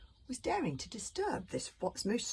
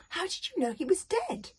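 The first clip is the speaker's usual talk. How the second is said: high, sharp demand; boy demanding the truth from his father; 14 semitones higher, 5 dB louder than usual. A narrator's reading. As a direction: insist on an answer. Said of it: "Hiccup demanded".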